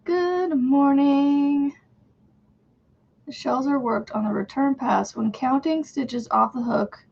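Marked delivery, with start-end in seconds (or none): 0.0-1.7 slow, drawn-out cheery good morning; sing-song greeting. Real, not acted. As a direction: stretch the greeting out sweetly.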